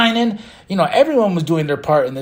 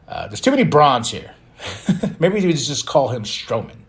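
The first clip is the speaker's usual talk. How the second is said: ordinary talk, nothing marked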